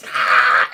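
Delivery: sudden very loud rasping screech; explosive creature attack cry; imitated dinosaur screech.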